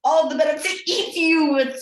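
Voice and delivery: loud snarling wolf. Acted. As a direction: snarl it and pounce. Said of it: "snarled the wolf".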